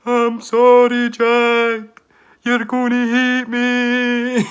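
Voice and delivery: high, loud, slow pleading shout up the stairs; retold shout. Drunk me shouting to Jack downstairs, high loud wail. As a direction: wail the apology long and high. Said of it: "me shouting".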